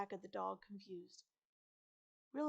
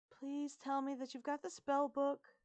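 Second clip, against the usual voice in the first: higher, louder worried plea; pleading; 6 semitones higher, 5 dB louder than usual. A narrator's reading. Girl-turned-dog to friends about spell book, pleading. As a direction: plead in a raised girl's voice.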